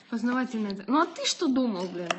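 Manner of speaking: ordinary talk, nothing marked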